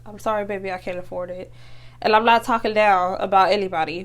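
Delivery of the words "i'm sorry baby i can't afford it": softer, lower apologetic murmur; apologetic mother. Retold or imitated. Mother telling her child no, soft and apologetic. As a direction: let a mother's apology sink quieter to the end. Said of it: "the mother that's like".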